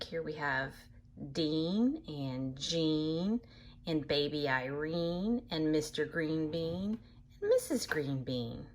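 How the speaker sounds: ordinary talk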